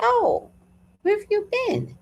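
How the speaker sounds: high-pitched feigned surprise; mock surprise. To the neighbour who finally stops by, high mock surprise. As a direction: act out a high, pointed greeting. Acted confrontation with neighbour at door.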